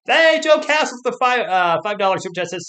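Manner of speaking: loud, high welcome to a donor; loud, high thanks to a super chat donor; excited shout-out. Real, not acted. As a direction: call out the viewer loudly and high.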